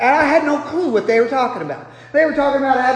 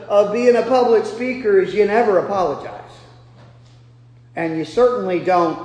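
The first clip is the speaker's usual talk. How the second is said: ordinary delivery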